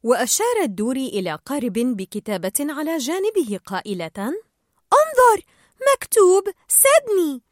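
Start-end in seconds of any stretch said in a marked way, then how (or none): 4.2-7.4 high, loud, stretched-out call; delighted, high-pitched exclaiming; excited discovery. A narrator's reading. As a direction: call out slowly and high, thrilled by the find. Dory excitedly points out boat.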